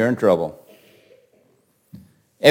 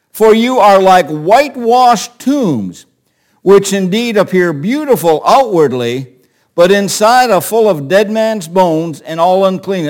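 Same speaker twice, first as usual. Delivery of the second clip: preaching at full force; 5 semitones higher, 5 dB louder than usual. Acted. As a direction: hammer each phrase home from the pulpit.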